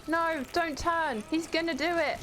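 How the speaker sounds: high-pitched gameplay alarm. Real, not acted. Player warning as an enemy closes in, alarmed.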